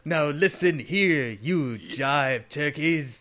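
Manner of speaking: very slow, stretched-out delivery; posturing movie character. Retold or imitated. Host quotes the jive-turkeys speech, slow mock posturing. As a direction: drag every word out with mock gravity.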